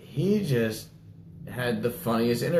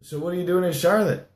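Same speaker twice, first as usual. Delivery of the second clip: reporter question; 9 semitones higher than usual. Retold or imitated. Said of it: "the reporter then asks him".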